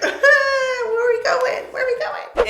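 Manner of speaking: high, slow, laughing diva voice; hammed-up high-pitched laughing show-off; feeling myself. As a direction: squeal it like a giddy diva showing off. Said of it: "can you tell I'm feeling myself? I'm like".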